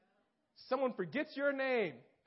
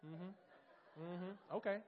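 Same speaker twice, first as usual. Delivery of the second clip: sarcastic mm-hmm; 4 semitones lower, 10 dB quieter, much slower than usual. Retold or imitated. Snubbed at a baptism.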